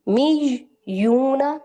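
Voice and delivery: मीज यूना slowly broken, y doubled; split slow syllables. Shown as a demonstration. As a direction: lay the syllables apart slowly. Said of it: "या साकिन है".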